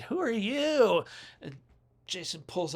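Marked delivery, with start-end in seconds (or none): 0.0-1.0 drawn-out slow question, pitched well up; puzzled girl asking who's there, slow and high; mock surprise. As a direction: slowly ask it, high and startled. Voicing Vera noticing the killer.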